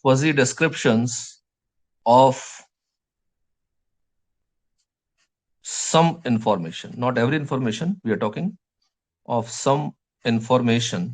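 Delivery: words stretched out slowly for note-taking; slow dictation. Shown as a demonstration. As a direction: spell it out slowly for the class to write. (धीरे-धीरे लिखवाना)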